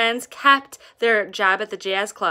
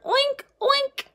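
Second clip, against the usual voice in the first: high squealing oink; pig oink. Acted. Hog answering the fly, high squeal. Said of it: "the hog answered".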